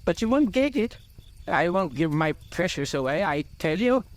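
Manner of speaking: high-pitched, possessive refusal; defiant cry. A narrator's reading. Bilbo refuses to give up his precious, defiant. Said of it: "cried Bilbo".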